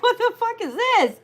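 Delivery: squeaky high bewildered squeal; mock disbelief. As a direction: act baffled at your own list. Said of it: "you're gonna be laughs like".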